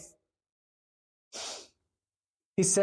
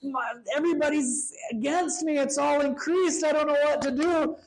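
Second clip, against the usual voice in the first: high-pitched mock despair; high, fretful lament, imitating panic; overwhelmed David; 8 semitones higher than usual. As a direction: whine it like someone swamped by trouble. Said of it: "instead of him being like".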